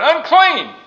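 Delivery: loud, high shout of warning. Acted. Crowd warning off a passing leper, shouting. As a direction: call it out to warn everyone off. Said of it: "covered their faces and shouted".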